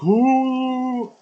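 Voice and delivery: sudden high mock "ooh"; imitating Northern Lion. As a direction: squeal a loud high "ooh" as the YouTuber. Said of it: "That was me ... impersonation of Northern Lion".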